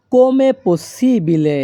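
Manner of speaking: very slow, every syllable laid out clearly; slow teaching demo. Shown as a demonstration. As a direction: spell the phrase out slowly for learners. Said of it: "এই বাক্যের Italian ভাষা হবে". (ধীরে ধীরে স্পষ্ট করে বলা)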